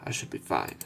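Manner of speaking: ordinary talk, nothing marked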